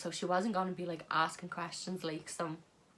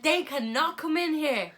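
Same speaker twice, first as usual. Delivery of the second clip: higher, louder bossy host voice; hammed-up stern host, higher and louder; mock bossy host; 9 semitones higher, 8 dB louder than usual. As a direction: play the stern host barring the door. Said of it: "he was like".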